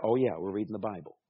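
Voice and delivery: quick sheepish self-correction; catching yourself. Retold or imitated. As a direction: mutter it quickly like you just remembered. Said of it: "your mind starts to go".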